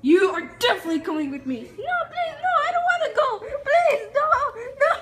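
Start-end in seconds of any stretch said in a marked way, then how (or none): 1.6-4.9 very high, fast begging; high panicked begging. Acted. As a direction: plead in a high rush. Begging again.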